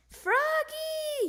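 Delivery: very high, loud, drawn-out yell. Acted. Dad annoyed by the saxophone, loud yell. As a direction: bellow the name through the house. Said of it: "yelled Dad".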